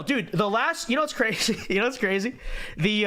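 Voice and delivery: ordinary talk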